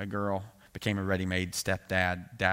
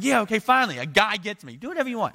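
much higher, louder triumphant glee; gleeful relief; 9 dB louder than usual. Retold or imitated. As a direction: crow it like you finally won. Young self thrilled by permission.

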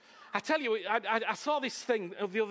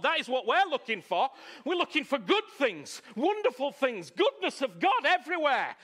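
raised, insistent sermon crescendo; fervent preaching; 4 semitones higher, 4 dB louder than usual. Real, not acted. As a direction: build higher and louder as you proclaim the good news. Preacher builds to a peak.

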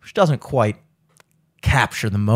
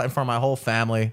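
usual speaking voice

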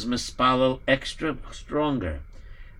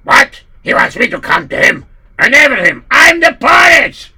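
outraged shout; 8 semitones higher, 12 dB louder than usual. Acted. Poritz outraged.

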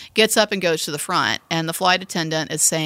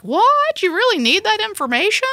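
much higher, disbelieving question; incredulous woman; 12 semitones higher than usual. Retold or imitated. The woman quizzed by the flight attendant, much higher in disbelief. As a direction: shoot up in disbelief. Woman asked for her schedule.

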